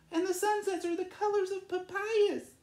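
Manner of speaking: high, light little swallow voice; Lolita's voice. Acted. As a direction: lift into a bright, high bird voice. Lolita describing the land.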